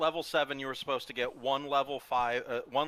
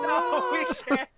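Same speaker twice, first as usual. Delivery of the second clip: high dramatic protest; 6 dB louder than usual. Acted.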